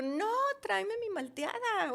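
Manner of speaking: high, insistent desperate demand. Retold or imitated. Patient refuses to wait for sister, acted desperate demand. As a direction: insist in a high, pleading retold cry. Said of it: "estaba yo desesperada".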